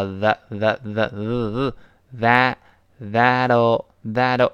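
"the" repeated with a buzzing th, drawn out slowly, into "that'll"; tongue-out TH. Shown as a demonstration. Teacher drilling the tongue-out sound, exaggerated TH. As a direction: exaggerate the voiced th from "the" through "that'll". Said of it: "T H要吐舌头".

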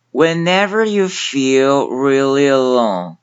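slow, careful English model line; slow demo reading. Shown as a demonstration. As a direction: read it out slowly for learners. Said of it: "其中最难的一个小部分".

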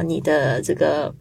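ordinary talk

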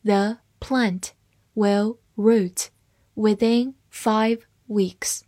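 slow word-by-word reading with pauses; slow read-along. Shown as a demonstration. Teacher models the plant sentence for learners, slow and broken up. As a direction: read slowly, leaving gaps between the words. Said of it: "慢慢来读".

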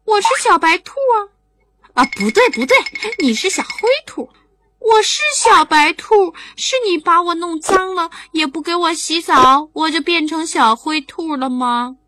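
put-on little rabbit blaming the boy for its dirt; indignant squeaky bunny; hurt little rabbit. A narrator's reading. Rabbit voice in story.

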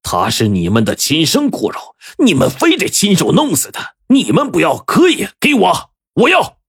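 high, strained angry shouting, building; furious bellow. A narrator's reading. Boss throws down the diagnosis before parents, furious bellow. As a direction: climb to an emphatic high-pitched demand. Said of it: "大吼着".

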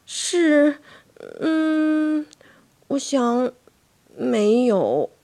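put-on high, halting child's reply; stalling with drawn-out hesitation; hesitant stammer. A narrator's reading. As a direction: falter between each word. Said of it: "我结结巴巴地说".

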